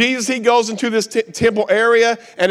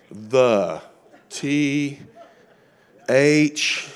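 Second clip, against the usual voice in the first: lower, halting letter-by-letter delivery; slow typing; 6 semitones lower, 3 dB quieter, much slower than usual. Acted. Preacher mimicking his own slow typing, haltingly slow. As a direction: spell it out haltingly, with long gaps. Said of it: "if you see me typing, I'm slow".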